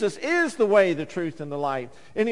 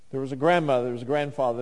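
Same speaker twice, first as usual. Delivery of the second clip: ordinary delivery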